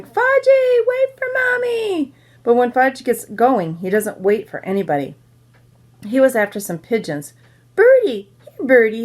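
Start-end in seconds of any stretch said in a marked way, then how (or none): 0.0-2.1 put-on much higher and louder, slow call after a running toddler; mother hollering. A narrator's reading. 7.8-9.0 a higher, louder call to the pigeons; toddler calling. A narrator's reading. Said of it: "he called".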